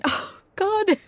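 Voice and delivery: breathless high "oh, God"; a sudden thrill breaking into the reading; giddy gasp. Acted. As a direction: gush with giddy delight. Giddy diary outburst.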